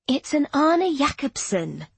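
slow, high and superior; lofty. Acted. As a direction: say it grandly, a little snooty. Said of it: "Lauren said loftily".

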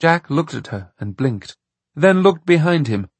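plain reading voice

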